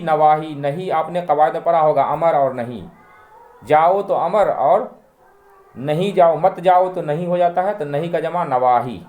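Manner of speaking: ordinary talk, nothing marked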